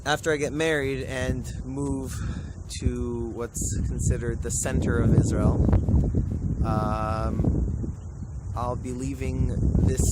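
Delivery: loud over strong wind; shouting over wind. Real, not acted. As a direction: raise your voice to carry over wind. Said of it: "I sure hope you can hear me over this wind".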